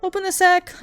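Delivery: loud, high shout from inside the sack. Acted. Little Klaus calling to the cattle drover, loud shout. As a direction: yell out to be freed. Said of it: "Little Klaus shouted".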